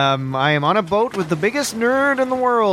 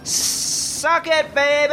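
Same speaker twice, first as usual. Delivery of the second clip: put-on drunken yell; 10 semitones higher, much slower than usual. Yelling at the lake.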